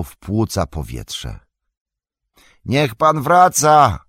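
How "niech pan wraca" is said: high, loud, drawn-out shout; slow, long-held pleading yell; calling out. A narrator's reading. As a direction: call someone back from far off. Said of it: "gdzieś nad nim wołał Sam".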